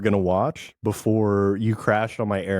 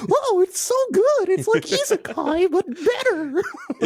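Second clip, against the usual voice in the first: very high squealing weeb voice; loud weeb. Acted. Podcast host mocking their own fandom, loud weeb voice. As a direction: gush in a high-pitched mock fangirl squeal. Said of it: "I'm just being a loud weeb over here".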